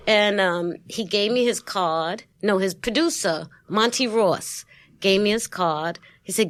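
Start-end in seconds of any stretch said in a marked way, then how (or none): none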